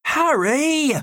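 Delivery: high, loud cry of dismay and anger; angry group cry. A narrator's reading. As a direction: yell his name in dismay. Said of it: "The cry came in unison, a cry of dismay and anger".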